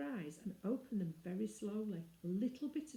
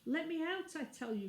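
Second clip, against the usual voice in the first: crying out; 9 semitones higher than usual. Acted. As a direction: insist sharply, a little girl in distress. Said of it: "cried Jane".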